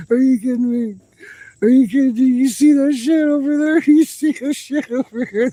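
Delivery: can't contain the thrill; overjoyed. Real, not acted. Digger pointing out the jade, high overjoyed disbelief. Overwhelmed by the find.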